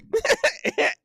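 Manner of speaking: very high burst of laughter; high giggle. Real, not acted. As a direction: crack up mid-bit. Cracks up at his own bit.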